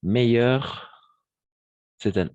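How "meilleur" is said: "Meilleur" punched high and loud with a rise; stressed key word. Shown as a demonstration. Teacher stresses "Meilleur" loudly for the class. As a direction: hit "Meilleur" hard and lift it.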